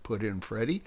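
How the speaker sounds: ordinary delivery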